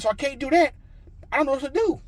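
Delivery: high, loud helpless protest; retold exasperation. Husband telling wife he can't fix it, exasperated. Despairing to wife about credit.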